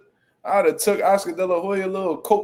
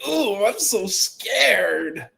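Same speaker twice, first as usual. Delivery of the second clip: higher, long drawn-out sarcastic fright; zesty mock fear; 4 semitones higher, much slower than usual. Streamer voicing the boxer's answer to a threat, zesty mock fear. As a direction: stretch "ooh" and "scared" mockingly. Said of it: "Kinda zesty with Floyd part".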